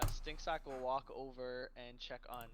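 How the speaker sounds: normal talking voice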